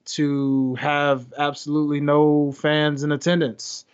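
ordinary talk

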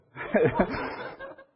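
short breathy laugh; real chuckle. Real, not acted. Teacher laughing at his own joke to the class, breathy chuckle. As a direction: let a quick laugh slip out.

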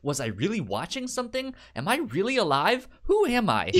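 hammed-up identity crisis; mock existential panic. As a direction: build the fake crisis to a peak.

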